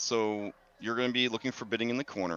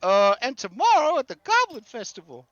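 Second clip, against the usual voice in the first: high, bubbly, rambling rancher; chatty, excitable Gus; 4 dB louder than usual. Acted. Rancher pitching his petition to strangers, eager and chatty. Game master plays Gus.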